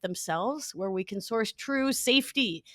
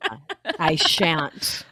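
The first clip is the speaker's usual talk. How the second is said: ordinary talk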